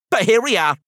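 high, loud Scottish teddy bear; high-pitched Scottish character voice; Scottish accent. A narrator's reading. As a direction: agree brightly in a Scottish accent. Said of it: "a voice with a soft Scottish accent".

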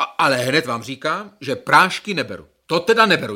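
no pills, and that's final; defiant refusal. Acted.

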